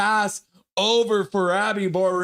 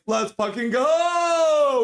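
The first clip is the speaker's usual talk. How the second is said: hype shout; 9 semitones higher, 3 dB louder, much slower than usual. Retold or imitated. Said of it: "Juliana's like".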